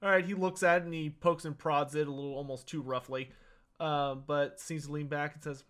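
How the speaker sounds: ordinary talk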